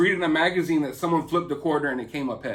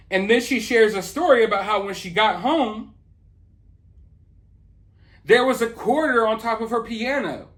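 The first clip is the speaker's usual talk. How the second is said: usual speaking voice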